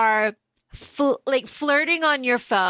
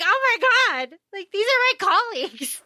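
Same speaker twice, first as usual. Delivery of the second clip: flustered; 8 semitones higher than usual. Retold or imitated. Retold Hermione flustered.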